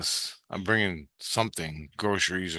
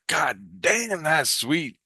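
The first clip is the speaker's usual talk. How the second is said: impressed exclamation; 8 semitones higher, much slower than usual. Retold or imitated. Sees the huge model battleship.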